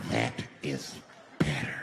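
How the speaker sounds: very low, drawn-out repeat; low menacing voice. Retold or imitated. Man terrorizing the bus to hushed passengers, low menace. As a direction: drop it deep and deliberate.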